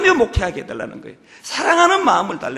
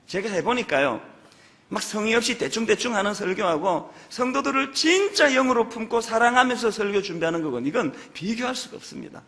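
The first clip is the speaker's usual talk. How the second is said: ordinary talk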